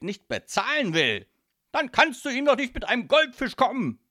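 loud mafia boss with high-pitched bursts; exasperated godfather. Acted. Godfather explains protection money to daughter, loud exasperation. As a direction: lecture your child in loud exasperation.

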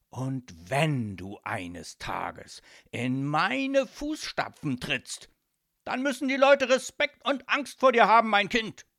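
put-on domineering father lecturing his child; grandiose proclamation. A narrator's reading. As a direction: build from weighty declaration to full-volume demand. Boss lectures daughter on legacy.

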